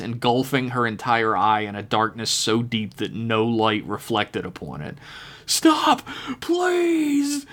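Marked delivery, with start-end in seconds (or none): 5.5-7.5 terrified scream. Acted. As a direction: scream for mercy. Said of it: "the man screamed".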